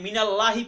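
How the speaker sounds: thin, open "laa" in মিনাল্লাহি; wrong light lam. Shown as a demonstration. Teacher showing what is not allowed, thin light "ল্লা" in মিনাল্লাহি. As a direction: say "মিনাল্লাহি" with a light "a", mouth unrounded. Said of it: "পড়ার সুযোগ নাই".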